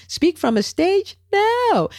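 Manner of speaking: high, incredulous mock-panicked no; mock horrified refusal. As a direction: shoot the idea down in disbelief. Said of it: "You're like".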